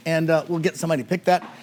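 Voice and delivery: normal talking voice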